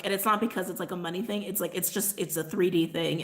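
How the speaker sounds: ordinary talk, nothing marked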